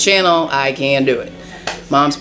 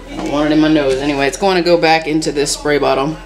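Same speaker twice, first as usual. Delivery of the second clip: normal talking voice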